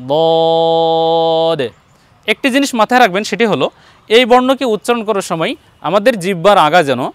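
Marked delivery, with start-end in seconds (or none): 0.1-1.6 বদ held long with tongue side; Arabic letter demo. Shown as a demonstration. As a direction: draw out বদ slowly. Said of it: "সুন্দর করে উচ্চারণ করি". (বদ জিহ্বার সাইড লাগিয়ে টেনে)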